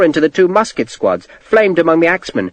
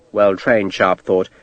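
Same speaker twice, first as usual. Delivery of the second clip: usual speaking voice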